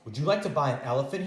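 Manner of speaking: fast, eager sales pitch; quick salesman. Acted. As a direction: rattle it off like a peddler. Pet man's sales pitch.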